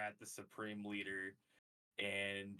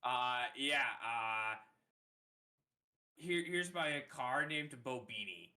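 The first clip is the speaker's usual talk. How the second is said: higher, proud clown voice; silly clown; 4 semitones higher, 4 dB louder than usual. Acted. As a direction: present the car like a clown. Clown shows off his car.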